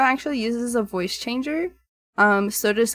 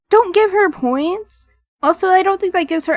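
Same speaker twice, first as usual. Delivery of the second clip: protesting; 9 semitones higher, 7 dB louder than usual. Acted. Protesting, slow and high.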